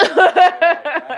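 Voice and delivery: laughing loudly while talking. Real, not acted. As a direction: burst out laughing mid-sentence. Laughs at the memory.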